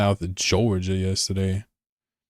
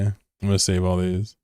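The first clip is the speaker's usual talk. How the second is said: normal talking voice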